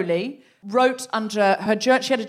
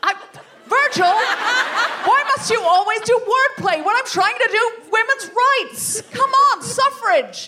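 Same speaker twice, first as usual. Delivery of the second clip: much higher and louder, fed up; exasperated scold; 11 semitones higher, 5 dB louder than usual. Acted. Ringleader scolds friend for puns, exasperated and loud. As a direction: snap back at the joker.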